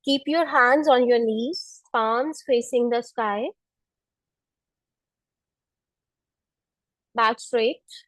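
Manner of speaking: calm, unhurried meditation voice; guided meditation. Acted. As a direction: guide them gently through each breath. Leads seated pranayama. (ध्यान कराने वाली शांत आवाज़)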